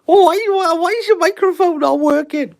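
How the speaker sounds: high, whining mock complaint; mock whiny viewer. Viewer whining about the microphone, mock high whine. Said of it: "mocking imagined viewer complaints".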